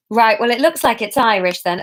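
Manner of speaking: ordinary delivery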